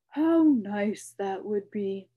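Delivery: slow, loud, dreamy royal voice; wistful king. A narrator's reading. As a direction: muse on better days. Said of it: "said Azaz".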